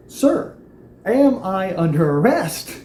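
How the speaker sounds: high, slow, deliberate question; to the officer tasing him, slow pointed question. Retold or imitated. Calmly challenging the officer tasing him.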